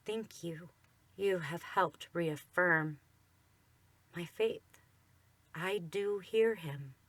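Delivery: soft, slow, emotional thanks; quietly choked up; voice cracking. Retold or imitated. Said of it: "I hear his voice crack".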